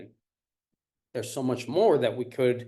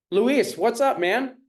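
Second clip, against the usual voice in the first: high, loud hello to a viewer; excited greeting; 8 semitones higher, 5 dB louder than usual. Real, not acted. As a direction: call out a cheerful hi.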